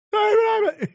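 sudden high cackle; loud high laugh. Real, not acted. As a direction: burst of laughter. Laugh alone.

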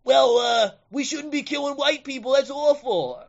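high, loud put-on prissy voice; sarcastic high-pitched imitation; mock dissenter. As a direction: parody a fretful objector.

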